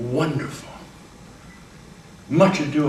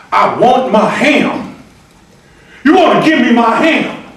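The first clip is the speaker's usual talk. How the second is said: Hambone demanding ham; 9 semitones higher, 9 dB louder than usual. Acted. Hambone demanding his ham, loud and insistent. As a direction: plead for the ham stubbornly. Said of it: "a character in Two Trains Running that says".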